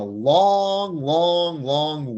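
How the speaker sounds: "long, long, long" very slow and elongated; drawn-out emphasis. Real, not acted. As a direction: stretch each "long" out, high and loud, then step down. Stressing how far the flight is.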